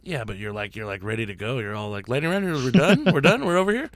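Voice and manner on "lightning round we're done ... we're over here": high, hurried-to-leave mock voice; imitating impatient wrap-up. Co-host mimicking the host rushing to end, mock impatient. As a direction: mimic someone itching to finish, pitched way up. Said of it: "your tone of voice was like".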